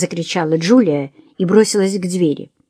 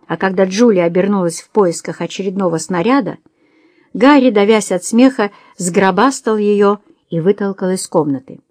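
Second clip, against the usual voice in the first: plain reading voice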